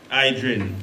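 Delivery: ordinary talk, nothing marked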